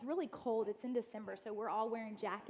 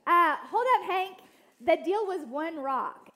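calling out; 7 semitones higher, 15 dB louder than usual. Retold or imitated. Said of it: "And I'm like".

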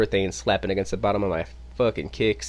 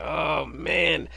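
high, softer mock groan; embarrassed dismay; 6 semitones higher, 4 dB quieter than usual. Walking away embarrassed from the manager, acted high dismay. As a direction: retell a crushed, embarrassed sigh in a raised voice. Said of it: "so embarrassed, uh, so terrible".

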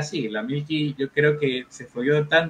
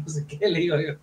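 usual speaking voice

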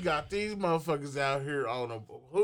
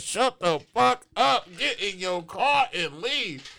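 louder, slow, pressing; urgent hushed-loud warning; 4 semitones higher, 6 dB louder, slower than usual. Retold or imitated. Uncle urging sister to shut up and go, loud and slow. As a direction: push her to leave now, firm. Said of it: "I was nervous".

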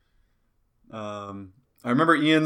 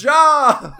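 loud high punchline; 12 semitones higher, 9 dB louder than usual. Pretend. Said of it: "tall dude with a job".

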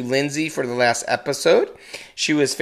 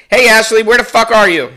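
calling out; 8 semitones higher, 11 dB louder than usual. Real, not acted. Calling out to wife across room.

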